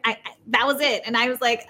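ordinary talk, nothing marked

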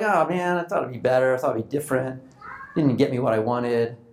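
mock the pouting brat, dropping low; grumpy pout. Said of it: "grumpy and pout, spoiled brat".

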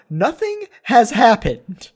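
drawn out, slow and loud, fed up; exasperated. Retold or imitated. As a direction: spell out the nothing in disbelief. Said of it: "I'm the only one who's sitting here like".

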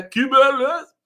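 high, loud [name] exclamation; hammed-up [name] cry. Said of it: "Como diria [name]".

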